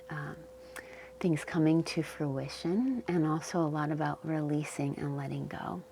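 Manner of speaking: calm Yoga Nidra guidance with long pauses; unhurried, leave space between phrases to settle them in; guided meditation. Real, not acted. Yoga teacher settling listeners into the earth's support, calm guided meditation.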